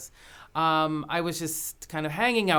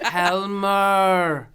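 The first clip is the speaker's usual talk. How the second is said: put-on louder Danish-accented call; 6 dB louder than usual. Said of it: "very thick Danish accent".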